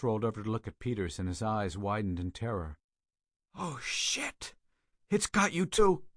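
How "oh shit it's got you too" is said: high panicked outburst; terrified cry. A narrator's reading. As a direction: shriek it in sheer horror. Dying man sees friend infected.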